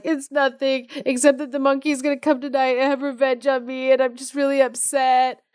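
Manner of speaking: high, put-on fretful voice; upset Pheasant. Pheasant confiding in door bar about the monkey, upset. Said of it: "he's like".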